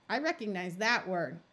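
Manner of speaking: usual speaking voice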